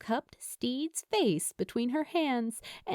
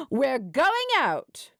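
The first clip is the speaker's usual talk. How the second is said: slow, louder, decisive announcement; excited friend; 4 semitones higher, 5 dB louder, slower than usual. A narrator's reading. Lou announcing to Steed they're going out, loud and decisive. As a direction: declare it like the decision is made. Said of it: "Lou said, standing up and slapping".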